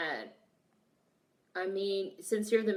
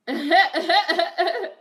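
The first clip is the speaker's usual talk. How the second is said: high loud burst of laughter; 9 semitones higher, 9 dB louder than usual. Real, not acted. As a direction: crack up loudly.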